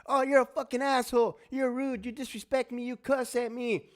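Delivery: high-pitched mock complaint; hammed-up indignant wife voice; mocking wife impression. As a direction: whine back like an offended wife. Voices wife complaining back in quarrel.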